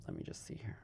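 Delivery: whispered to herself; whispering. Real, not acted. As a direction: breathe it out, no voice. Repeated as a whisper.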